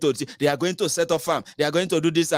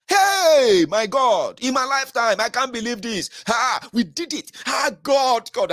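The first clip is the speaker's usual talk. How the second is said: high, loud overjoyed cry of disbelief; mock emotional fan; 10 semitones higher, 4 dB louder, slower than usual. Mocking Obi fans, overjoyed near-tearful disbelief. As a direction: play a fan near tears with joy. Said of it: "and start crying".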